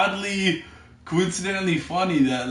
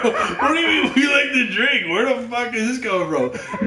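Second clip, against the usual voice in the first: high, loud, laughing protest; mock indignation; 8 semitones higher, 4 dB louder than usual. Replying to the host who says Canadians like to drink, mock indignation. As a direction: protest incredulously through a laugh. Retold protest at relative's remark.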